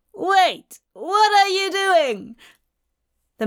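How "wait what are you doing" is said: alarmed. Acted. Magus sees guard about to shoot.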